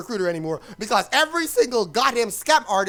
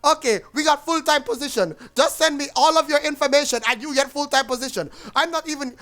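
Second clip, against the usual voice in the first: mock thick Indian accent, pitched higher; higher-voiced scam recruiter with an Indian accent; 4 semitones higher than usual. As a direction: imitate a pushy caller with a heavy Indian accent. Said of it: "thick ass Indian accent who's going".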